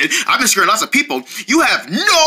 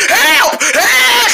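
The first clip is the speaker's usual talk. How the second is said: calling for help; 12 semitones higher, 4 dB louder than usual. Acted. Chased by the logo.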